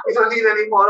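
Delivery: high, laughing refusal; laughing Thai visitors. Retold or imitated. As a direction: brush it off with a laugh.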